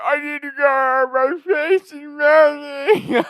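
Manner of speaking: high, slow, whimpering whine; mock whining panic. Older brother begging to go home, mock whimper. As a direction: whimper like a freaked-out tripper.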